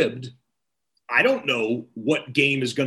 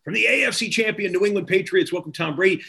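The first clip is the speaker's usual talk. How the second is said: raised-pitch emcee introduction; stage introduction; 6 semitones higher, faster than usual. Retold or imitated. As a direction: present the champions like an announcer on stage. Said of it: "introduce the team captains".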